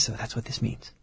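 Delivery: soft unvoiced whisper. Shown as a demonstration. Parent at mass whispering to the kids, hushed whisper. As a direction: breathe it so only the child hears. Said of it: "I can whisper to my kids".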